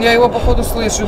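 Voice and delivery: ordinary talk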